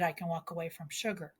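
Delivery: quick murmured echo of the line before; quick quiet throwaway. Shown as a demonstration. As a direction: toss the repeat off as a quick aside. Repeated line, tossed off quietly.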